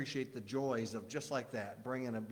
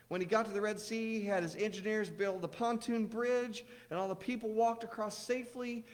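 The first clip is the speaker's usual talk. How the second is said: put-on higher, eager kid storytelling; little boy voice; 8 semitones higher, 3 dB louder than usual. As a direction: tell it like an excited child. Joey voiced in joke.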